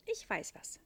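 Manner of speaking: breathless whisper. A narrator's reading. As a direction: gasp it out. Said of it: "sagt der Engel plötzlich atemlos".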